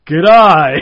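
a loud Australian greeting; Australian accent. Retold or imitated. Comic recovering on stage, loud Australian accent. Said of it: "supposed to be Australian for this".

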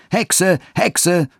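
high-pitched jeering cry of a mob; crowd screaming abuse. A narrator's reading. Street boys taunting old Gertrud, high jeering cries. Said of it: "und schrien".

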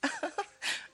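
short amused chuckle; light laugh. Acted. A guest amused by the host's odd question, light laugh. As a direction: chuckle lightly before replying.

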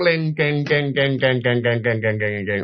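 jingling keys rolling away into silence; imitated clinking keys. Storyteller mimics keys bouncing down the stairs, fading clinks. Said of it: "cho nó lăn với cầu thang".

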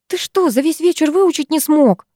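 high-pitched, mildly irritated grumble; grumbling. A narrator's reading. Girl chiding a lost classmate, grumbling. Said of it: "проворчала я".

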